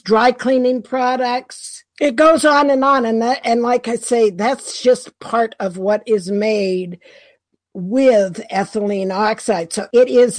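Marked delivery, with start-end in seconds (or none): none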